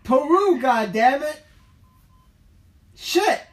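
frustrated cursing, high and loud; angry swearing. Real, not acted. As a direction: snap the curse in exasperation. Frustrated cursing on a bad call.

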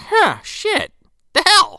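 a baffled squeak of disbelief; confused high mock surprise. Clint finding nothing happens, high confused surprise. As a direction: pitch it way up, puzzled and startled. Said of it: "He's like".

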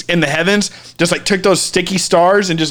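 ordinary delivery

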